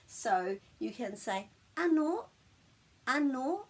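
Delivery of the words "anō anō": anō asked high and loud, rising like a question; "anō?" with a lift, asking them to repeat. Shown as a demonstration. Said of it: "it's kind of the tone of your voice".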